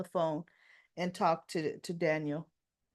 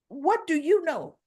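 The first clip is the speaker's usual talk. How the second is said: higher, louder upset demand; adamant, upset; 5 semitones higher, 7 dB louder than usual. Retold or imitated. Lucy confronts Sharon over her mother's disappearance, adamant and upset. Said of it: "she's saying it adamantly, like, upset".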